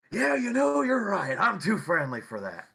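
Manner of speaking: sheepish agreement. Acted. Player's character agreeing he's too friendly, high goofy voice. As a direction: cave in happily, a little sheepish.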